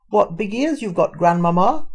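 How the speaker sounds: put-on high, loud girl in surprise; little girl amazed; surprised little girl. A narrator's reading. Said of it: "she said in surprise".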